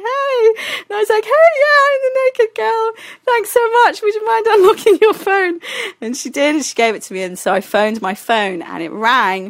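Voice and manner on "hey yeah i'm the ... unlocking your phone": very high, sheepish politeness; awkward chirpiness. Retold or imitated. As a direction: re-enact over-sweet asking in a squeaky voice. Embarrassed request to woken hostess.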